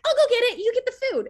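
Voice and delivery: high, loud eager chirp; high excited offer. Retold or imitated. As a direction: bounce off to get it. Eagerly volunteering for champagne.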